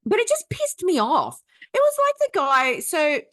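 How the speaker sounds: loud, high-pitched frustrated outburst; exasperated rant. Real, not acted. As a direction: let the anger burst out. Ranting about the rude man.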